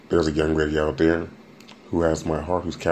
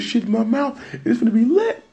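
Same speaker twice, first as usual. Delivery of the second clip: excited squeal. Real, not acted. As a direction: shriek with delight.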